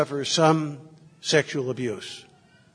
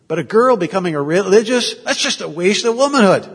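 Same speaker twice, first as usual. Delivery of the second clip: mock-dismissive, higher and louder; scornful quote; 5 semitones higher, 6 dB louder than usual. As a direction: play the locals scoffing at a daughter becoming a nun. Mimicking the local cultures' view.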